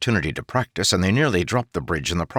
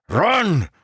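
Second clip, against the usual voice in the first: high, loud command; shout; 12 semitones higher, 4 dB louder than usual. Acted. Sergeant Gaz shouting to the bridge crews, loud command. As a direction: bark the order to flee. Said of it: "Gaz's voice shouted".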